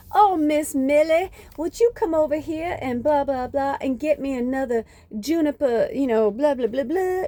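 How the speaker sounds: high, put-on Southern US accent; mock an old-time Southerner calling out, accent laid on thick; rough Southern accent. Mimicking a slaveholder calling Miss Millie over, hammed-up Southern accent. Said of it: "I know that's not the best accent".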